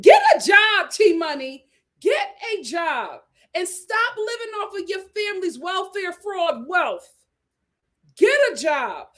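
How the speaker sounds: loud, laughing scold at T Money. Real, not acted. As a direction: get a job, yelled half-laughing.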